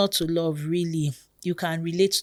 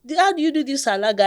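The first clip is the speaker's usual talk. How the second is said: amazed question; 7 semitones higher, 5 dB louder, faster than usual. Retold or imitated. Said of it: "So when people say".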